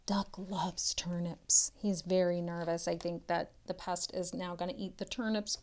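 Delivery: soft, quick aside; hushed aside. Shown as a demonstration. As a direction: confide it quietly. Aside whispered to child.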